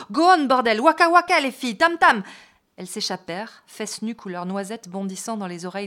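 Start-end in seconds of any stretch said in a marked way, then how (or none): 0.1-2.2 loud high yells, pleading; frantic shouting. A narrator's reading. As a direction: bellow at the girls to keep playing. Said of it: "leur criait Kueso".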